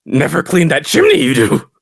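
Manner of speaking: high, scolding elf voice; grumpy little creature. Acted. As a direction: scold like a cranky little goblin. Green elf creature scolds in character.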